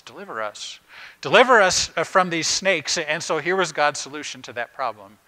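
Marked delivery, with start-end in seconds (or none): 0.0-0.8 a soft, hushed first plea for rescue; mild pleading, held back low; quiet plea. Retold or imitated. People crying out to God for rescue, first softly. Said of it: "the people cried out to God". 1.2-3.0 louder, higher desperate plea; crying out. Retold or imitated. Snake-bitten people crying out to God, a loud plea. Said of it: "the people cried out to God".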